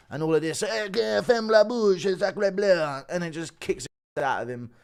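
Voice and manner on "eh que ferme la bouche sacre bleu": slow, exaggerated French accent; hammed-up French accent; french chef. Mock French fighter continues.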